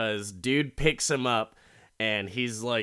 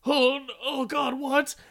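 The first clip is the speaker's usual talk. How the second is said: much higher yelp of shocked pain; pained squeal; shot in pain; 14 semitones higher than usual. Retold or imitated. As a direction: cry out as the bullet hits. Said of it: "he's like".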